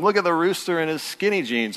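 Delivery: mocking. A narrator's reading. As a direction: imitate farm animals making fun of someone. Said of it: "Everybody's making fun of him".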